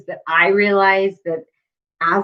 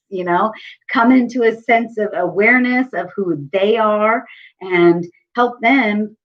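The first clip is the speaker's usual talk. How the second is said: ordinary talk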